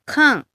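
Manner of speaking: カン said sharply falling from high, fourth tone; Mandarin fourth tone. Shown as a demonstration. Teacher showing learners the falling tone on カン. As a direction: drop カン hard from the top, kàn. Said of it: "下がる、四声ですね".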